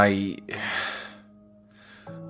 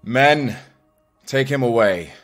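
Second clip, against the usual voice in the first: higher, called-out order; commanding; 5 semitones higher, 5 dB louder than usual. Acted. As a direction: call the troops to take a prisoner. Commander orders prisoner removed.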